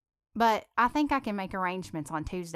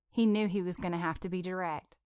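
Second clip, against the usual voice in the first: ordinary delivery, nothing marked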